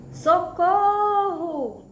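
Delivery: high, loud, very slow sung-out cry; cry for help. Shown as a demonstration. Teacher acting out an exclamation for students, a loud drawn-out cry. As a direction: call out for help as if in danger. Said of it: "ponto de exclamação".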